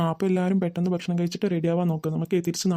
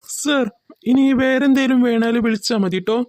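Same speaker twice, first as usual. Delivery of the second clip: loud, raised-pitch announcement; waiter calling out; 4 semitones higher, 6 dB louder, slower than usual. Acted. Waiter offering guests anything more, loud and high. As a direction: call it out clearly to the table.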